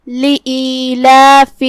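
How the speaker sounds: لإیلاف with both long vowels held two counts each; لإیلاف recited with equal two-count madd. Shown as a demonstration. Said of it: "ہم اپنی آواز دو مد کے برابر لمبی کریں گے".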